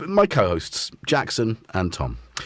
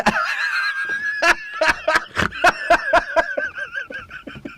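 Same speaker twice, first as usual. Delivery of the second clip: high shrieking burst of laughter; real shriek of laughter. Real, not acted. Laughing at being called Rick, a high shriek.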